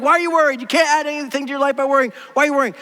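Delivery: impatient mock advice; dismissive uncle type. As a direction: lightly imitate a blunt uncle brushing off worry. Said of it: "Jesus just seems to be that way".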